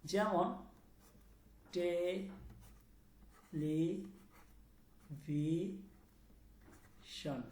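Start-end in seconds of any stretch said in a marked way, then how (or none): 1.7-7.4 very slow, drawn-out television with gaps between syllables; stretched, separated syllables in television. Shown as a demonstration. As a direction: sound out television one piece at a time, softly. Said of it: "খুব ভালো করে খেয়াল করবেন".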